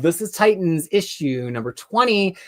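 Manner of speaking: normal talking voice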